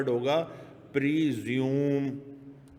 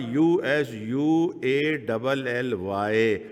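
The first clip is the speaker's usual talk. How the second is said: U S U A double L Y, slow and deliberate, letter by letter; spelled out; 5 semitones lower, 4 dB louder, slower than usual. Shown as a demonstration. Teacher spells usually for students, slow and deliberate. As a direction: spell يو ايس يو اے ڈبل ايل Y slowly and firmly. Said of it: "ایک لفظ بولنا ہوگا".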